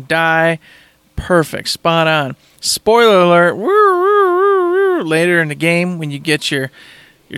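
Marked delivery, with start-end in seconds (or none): 2.6-4.7 loud warning, then high drawn-out siren woos; playful spoiler alarm, rising into high whoops; mock alarm siren. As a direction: imitate a wailing alert siren.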